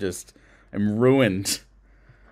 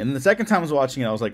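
usual speaking voice

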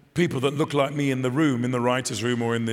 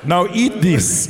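mum voice; 4 semitones higher, 8 dB louder, much slower than usual. Retold or imitated. Mum voice punchline, laughing.

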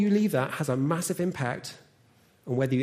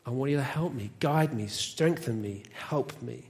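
lower, soft, unhurried appeal; sincere prayer; 5 semitones lower, 4 dB quieter than usual. Shown as a demonstration. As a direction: speak humbly and privately to God. Models a daily prayer to God.

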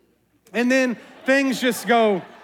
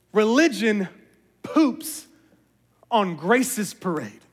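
each word set apart by pauses; Religion, pause, poops, pause, on Grace's parade, slow and deliberate; slow separated words; 3 semitones lower, much slower than usual. Shown as a demonstration. Preacher repeats the line to the church, word by word. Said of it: "word by word repeat".

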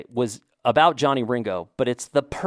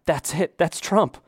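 higher, eager "that's it"; a sudden light-bulb moment, pitched up; excited recognition, retold; excited realization; 7 semitones higher than usual. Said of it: "And I'm like".

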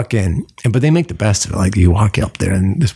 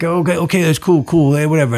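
higher-pitched busboy patter; imitated busboy; 8 semitones higher than usual. Busboy brushing off the table's reply, imitated. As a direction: reel it off as the busboy.

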